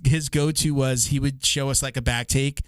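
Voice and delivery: ordinary talk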